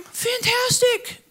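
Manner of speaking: high, slow, drawn-out cheer; enthusiastic. Real, not acted. Pastor cheering on emotionally wired listeners, high and drawn-out. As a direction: stretch out fantastic like a big cheer.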